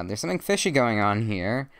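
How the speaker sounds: loud voiced suspicion; acted complaint. A narrator's reading. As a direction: raise your voice as a suspicious fisherman. Fisherman's complaint acted in a story.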